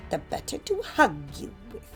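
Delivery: put-on false old-lady sweetness; wolf posing as grandmother. A narrator's reading. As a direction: coo as the disguised wolf. Disguised wolf replies.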